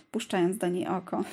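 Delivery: usual speaking voice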